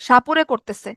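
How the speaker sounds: ordinary delivery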